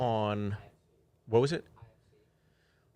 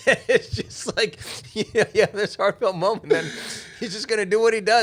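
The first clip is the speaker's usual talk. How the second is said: much higher, louder, laughing talk; 10 semitones higher, 7 dB louder than usual. Real, not acted. Telling a comic about Martin, laughing. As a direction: talk through real laughter.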